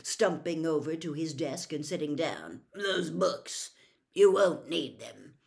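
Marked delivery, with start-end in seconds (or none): 2.7-5.4 gruff. Acted. Moody continues gruffly.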